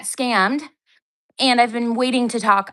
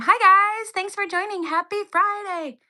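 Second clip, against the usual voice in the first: high, peppy hello to the viewers; chirpy livestream host greeting; bright mock greeting; 8 semitones higher than usual. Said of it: "And I told them".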